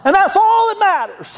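very high reenacted holler; top-of-lungs shout. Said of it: "to the top of his lungs".

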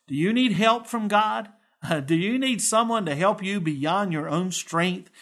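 ordinary delivery

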